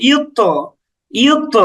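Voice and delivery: "ইউত" with a bounced heavy t; pushed qalqalah. Shown as a demonstration. Teacher pushes the letter to show learners, bouncing qalqalah. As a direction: give the final t of "ইউত" a push and release. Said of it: "এইরকম".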